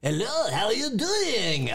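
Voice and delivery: high, drawn-out fake French accent; mock French accent. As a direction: ham up a slow French accent. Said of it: "That was a great accent".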